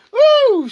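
high loud mock cheer; sarcastic woo. Ranting at the article, sarcastic whoop. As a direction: whoop in fake celebration.

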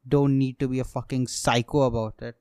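normal talking voice

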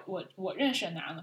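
ordinary talk, nothing marked

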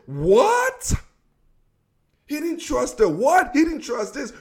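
high, rising disbelief; incredulous. Acted. Host rejecting excuses for the coach's play call, incredulous. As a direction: throw it back as an outraged question.

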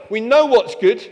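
ordinary talk, nothing marked

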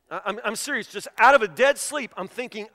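ordinary talk

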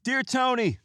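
high, loud formal letter reading; letter opening. Shown as a demonstration. Opening a viewer letter with a high, loud flourish. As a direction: read the greeting like a grand announcement. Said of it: "this is from Frank".

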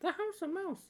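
high squeaky cartoon mouse voice; Mickey Mouse voice. Joining the friend's Mickey joke, a high mouse squeak.

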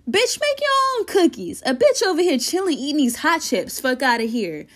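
put-on high, fast sassy girl voice; sassy high retort. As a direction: toss it back, high and mocking. Girl snaps back at friend.

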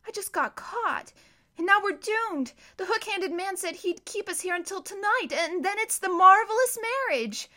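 a girl voicing doom; despairing girl. A narrator's reading. Violet telling her brother they are doomed, high and despairing.

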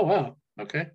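ordinary delivery

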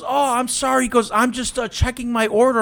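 high, meek mock apology; clueless driver. Imitating the clueless driver's excuse, high and meek.

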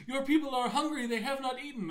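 high, earnest plea; solemn follower. Retold or imitated. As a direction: report the hunger, high and grave. Follower tells Brian of hunger.

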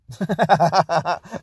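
high rapid chuckle; laughing. Real, not acted. Man laughing about dog drool in his coffee, amused. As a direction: burst into a run of laughter.

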